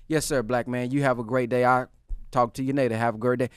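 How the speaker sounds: low, soft, courteous reply; composed. Acted. Man politely answering someone who cussed him out, composed. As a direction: keep your cool, quietly and low. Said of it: "he's able to keep his composure".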